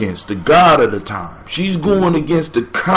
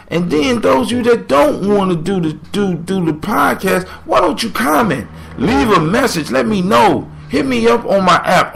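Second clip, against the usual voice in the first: excited urging; 7 semitones higher than usual. Real, not acted. Urging listeners to comment.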